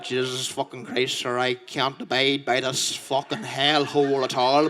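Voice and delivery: a hint of a stuffy gent grumbling; lightly voiced posh complainer. Bouncer moaning to the comedian in the club, lightly posh. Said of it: "he's like".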